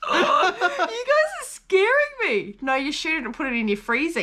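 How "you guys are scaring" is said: high, slow, laughing pretend fear; laughing mock fright. Real, not acted. Host teasing guests about scary wildlife, laughing mock fear. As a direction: squeal it out through laughter, half scared.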